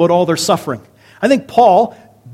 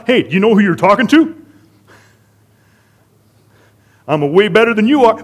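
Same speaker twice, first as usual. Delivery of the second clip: pitched-up, mildly swaggering boast; mock swagger; 4 semitones higher, 4 dB louder than usual. Preacher mimicking what Jesus never did, mock swagger. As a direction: pull rank with a hammed-up boast. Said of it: "didn't go parading around".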